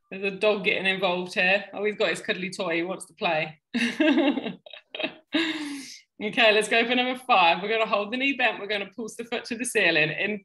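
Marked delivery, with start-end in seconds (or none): 3.7-5.9 amused laugh. Real, not acted. Dog with cuddly toy.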